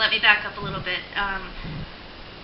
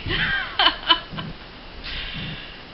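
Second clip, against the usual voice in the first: breathy laugh. Real, not acted. Laughs after "not today.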